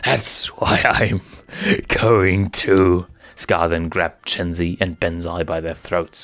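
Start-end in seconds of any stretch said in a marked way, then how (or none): 0.0-3.1 slow, menacing lion villain. A narrator's reading. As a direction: let the threat hang slowly.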